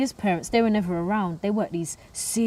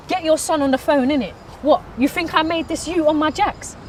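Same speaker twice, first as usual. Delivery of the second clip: raised, higher-pitched challenge; indignant confrontation; 7 semitones higher, 4 dB louder than usual. Acted. Girl confronting boyfriend's dad, indignant. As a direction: demand answers, loud and high. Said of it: "I told the dad".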